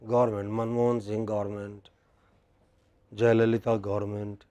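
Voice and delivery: "government" said as GOR-ment, softly; wrong pronunciation. Shown as a demonstration. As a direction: drop the middle sounds in "government". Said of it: "They say".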